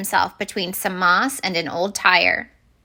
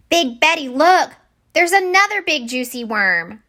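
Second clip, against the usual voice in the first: high, eager shout; excited little minnow; 7 semitones higher, 4 dB louder than usual. Acted. Minnow calling Big Betty to another worm, high eager shout. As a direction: point it out with glee.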